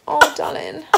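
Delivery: a light high coo to her daughter. Real, not acted. Mother breaks off to coo at her baby, light and high. Turns to baby mid-sentence.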